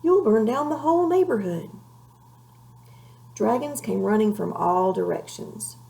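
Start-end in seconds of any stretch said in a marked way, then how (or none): 0.0-1.7 higher, louder alarmed cry; mother crying out as flames spread. A narrator's reading. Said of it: "cried his mother".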